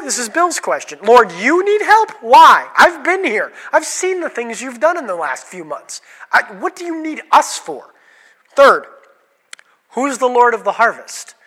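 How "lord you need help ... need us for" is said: incredulous apostle. Acted. Voices a baffled apostle.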